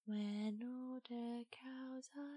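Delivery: soft, slow sing-song recital; hushed nursery rhyme. Pretend. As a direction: murmur it like a lullaby.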